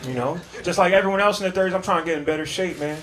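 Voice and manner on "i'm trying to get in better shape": ordinary delivery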